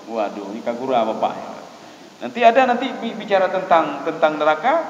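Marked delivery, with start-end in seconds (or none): none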